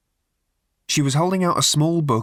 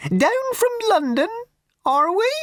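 simpering raffle woman. Acted. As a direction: drag it out with prim theatricality. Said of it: "talk so bloody slowly".

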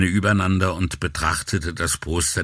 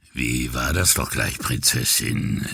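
low gravel vigilante growl; 6 semitones lower than usual. Acted. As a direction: growl it like a sleazy flirt. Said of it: "mit tiefer Stimme". (tiefe Reibeisenstimme eines Anmachers)

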